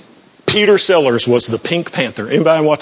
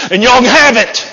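high, louder gleeful punch; triumphant; 7 semitones higher, 4 dB louder than usual. Acted. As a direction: toss it off with glee.

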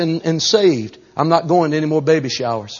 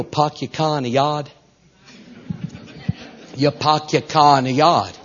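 put-on r-less Boston accent; slower than usual. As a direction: drop the r's like a Bostonian. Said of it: "Bostonian from Boston".

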